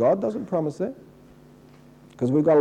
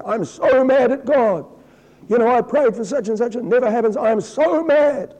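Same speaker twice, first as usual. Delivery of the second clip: whiny complaining churchgoer; 8 semitones higher than usual. Retold or imitated. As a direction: imitate a sulky believer whining at God. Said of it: "I've had people come to me and say".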